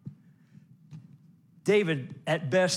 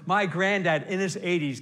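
ordinary talk

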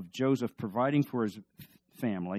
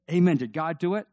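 usual speaking voice

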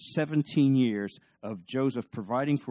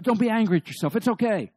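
higher and louder, brightly dismissive; eager reassurance; 10 semitones higher, 7 dB louder than usual. Retold or imitated. Joseph telling his brothers not to be angry, higher and louder. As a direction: brush off their guilt with cheer.

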